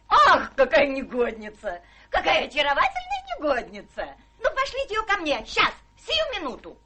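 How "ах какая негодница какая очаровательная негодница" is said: chuckling with delight; amused laughing exclamation. Acted. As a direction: laugh through the words, charmed. Delighted at secret marriage.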